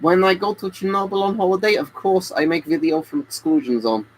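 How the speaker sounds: put-on jokey Russian accent, selling masks to chat. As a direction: play a comic Russian streamer in a Russian accent. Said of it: "the next few questions I will read in this accent".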